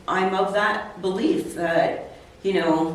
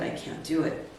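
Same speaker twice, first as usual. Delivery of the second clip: near whisper; 3 semitones lower, 6 dB quieter than usual. Retold or imitated. To her coach about the cost, a soft defeated whisper. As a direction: repeat it breathless and soft, barely voiced. Refusing costly conference ticket.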